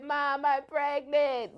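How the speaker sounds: high, drawn-out mock wail; imitated tearful outburst. Telling mom she's pregnant, acting tearful like a sixteen-year-old. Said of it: "I just burst out into tears".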